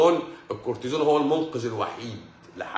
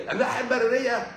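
breaks into a laugh on the words, pitched higher; 10 semitones higher than usual. Real, not acted. Doctor explaining to viewers, laughing. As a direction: let a real laugh spill through.

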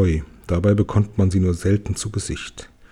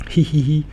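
high light tittering hee-hee; dwarf's giggle; 5 semitones higher than usual. Acted. The dwarf giggles at the friends' questions, high giggle. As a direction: giggle mischievously like a gnome. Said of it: "kichert der Zwerg".

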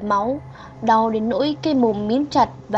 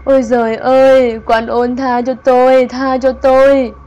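gào khóc van xin; wailing plea; 2 semitones higher, 10 dB louder than usual. Acted. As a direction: howl at midnight begging the spirit. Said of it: "bà gào lên".

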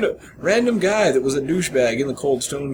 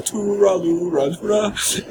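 mock drunk singsong; 8 semitones higher, much slower than usual. Drunk guys walking through alley.

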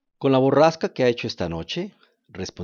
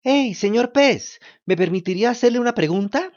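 calling out loudly; 11 semitones higher, 5 dB louder than usual. Acted. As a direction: shout it out to be heard. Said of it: "le gritó fuerte para hacerse oír".

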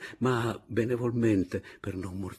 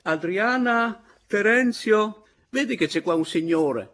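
calling out; 13 semitones higher, 7 dB louder than usual. Acted. Father summoning daughter to a visitor, loud high call. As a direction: shout through the house for your family. Old man calls his household.

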